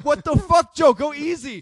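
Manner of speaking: high, loud reenacted protest; alarmed passenger yell. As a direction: yell at a reckless driver, retelling it. Retells scolding reckless driver friend.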